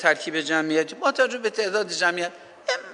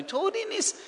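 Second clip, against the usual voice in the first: put-on much higher and softer "طوری نیست", like calming a child; tender soothing; 7 dB quieter than usual. Shown as a demonstration. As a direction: coo "طوری نیست" softly up high. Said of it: "فرمانهای آرامش دهنده". ("طوری نیست" با صدای زیر و نرم و نوازشگر)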